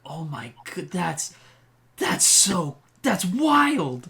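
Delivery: building amazement; amazed kid. Acted. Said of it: "very intrigued. I'm like".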